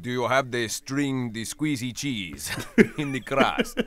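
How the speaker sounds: slow, loud mock-Italian accent with laughter. Italian caller asking a pizza place about cheese, Italian accent. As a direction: ask in a hammed-up Italian accent, amused. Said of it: "what kind of a full-blooded Italian would call".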